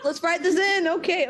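high, loud, laughing tipsy enthusiasm; mock drunk writers. Imagining drunk writers adding a character, giggly mock enthusiasm. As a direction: blurt it like a giddy drunk writer. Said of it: "Maybe they all got drunk".

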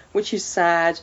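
normal talking voice

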